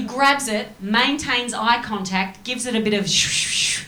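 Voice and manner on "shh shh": whispered shh of a sheet being shaken out; unvoiced snapping sounds; mimicked sheet snap. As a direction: hiss like a flicked sheet.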